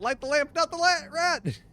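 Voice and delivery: a hammed-up high squeak of the lamp line; silly squeaky voice. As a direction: squeal it like a cartoon.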